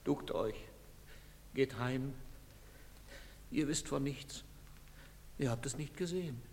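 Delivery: soft, subdued accusation; bitter and hushed, sentence by sentence; quiet reproach. Acted. Parent to cowardly bystanders, quiet reproach.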